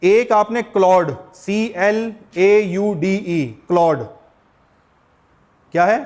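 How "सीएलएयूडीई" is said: very slow, letter by letter; spelled out slowly. Shown as a demonstration. Teacher spells a tool name for students, slowly letter by letter. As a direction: spell it out, one letter at a time.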